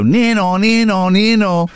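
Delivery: high sing-song nee-naw siren; siren imitation. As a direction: mimic a British ambulance siren. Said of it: "we would go".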